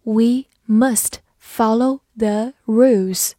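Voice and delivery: one word at a time, slowly; slow model reading with gaps between words. Shown as a demonstration. Teacher reads the rules example for learners, slow reading. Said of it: "慢读一遍".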